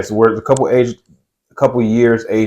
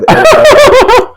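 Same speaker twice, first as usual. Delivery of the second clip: laughing; 6 dB louder, slower than usual. Real, not acted. Laughing at gross moment.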